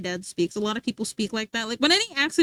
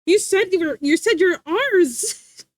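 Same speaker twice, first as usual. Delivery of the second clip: high, loud exaggerated gotcha; mock-scandalized, higher and louder, stretching the last words; mocking onlookers; 4 semitones higher, 5 dB louder than usual. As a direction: imitate people teasing someone's pronunciation. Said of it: "They're like".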